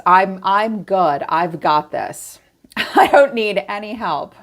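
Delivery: mock stubborn reassurance. Imitating a stubborn listener.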